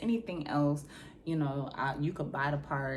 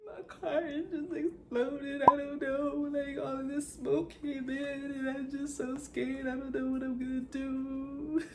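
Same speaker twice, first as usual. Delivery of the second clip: high, tearful mock sobbing; retold crying; 10 semitones higher than usual. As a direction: whimper it out like a panicked girl in distress. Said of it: "boohoo crying".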